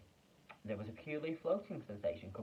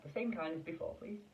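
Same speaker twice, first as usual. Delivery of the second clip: usual speaking voice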